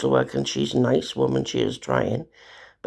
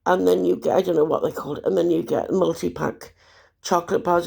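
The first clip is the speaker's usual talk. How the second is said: usual speaking voice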